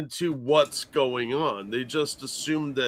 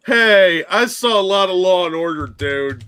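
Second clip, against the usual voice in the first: loud exclamation; 4 semitones higher, 8 dB louder than usual. Real, not acted. Scoffing at a staged break-in.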